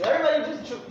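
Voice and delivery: normal talking voice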